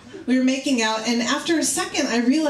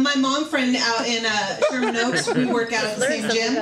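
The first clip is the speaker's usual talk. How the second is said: ordinary delivery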